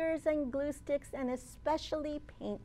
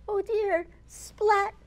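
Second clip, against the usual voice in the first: higher oh dear, splat; mock dismay; 7 semitones higher, 6 dB louder, slower than usual. A narrator's reading. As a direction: sound gently alarmed. Paint spills.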